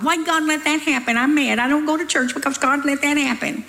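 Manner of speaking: mad blamer. Acted. People blaming God for what happened, mock-mad whining. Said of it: "People are always blaming God".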